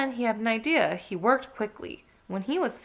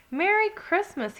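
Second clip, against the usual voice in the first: shouting; 10 semitones higher than usual. Acted. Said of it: "he shouted".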